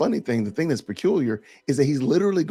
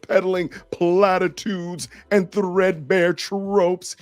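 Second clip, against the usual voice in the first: high, loud, sneering read of the reply; mock the critic's smug tone; 7 semitones higher, 5 dB louder, slower than usual.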